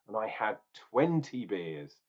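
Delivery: usual speaking voice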